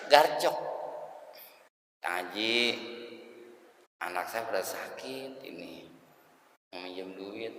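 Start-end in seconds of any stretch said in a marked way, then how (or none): 2.0-7.5 meek borrower. Acted. Humble borrower pleading to Haji.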